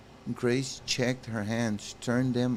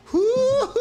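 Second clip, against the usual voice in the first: laughing whoop; 9 dB louder than usual. Real, not acted. Reacts to Anna's line.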